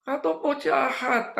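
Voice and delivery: high, slow mock wail; imitated hysterics. Preacher echoes the expelled girl, imitated hysterical wail. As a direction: stretch out the accusation in a high cry. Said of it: "dia histeris".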